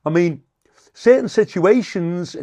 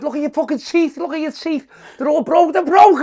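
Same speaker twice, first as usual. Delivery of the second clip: high and louder, building mockery; stage heckle, getting louder and higher; mocking heckle; 11 semitones higher, 6 dB louder than usual. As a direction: jeer at him, rising to a yell. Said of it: "I said".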